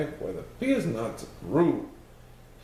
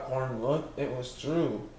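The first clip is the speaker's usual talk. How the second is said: ordinary delivery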